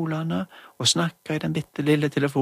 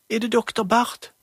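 higher, louder calling hallo; playing mother; 8 semitones higher, 3 dB louder than usual. Julie playing mum calls the doctor, high and loud. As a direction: call into the toy phone like a mum. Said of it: "Det var Julie som snakket for mammen".

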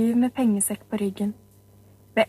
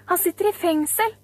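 calling out; 8 semitones higher than usual. Acted. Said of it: "ropte en annen".

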